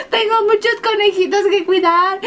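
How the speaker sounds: laughing mock plea. Pleading joke while laughing.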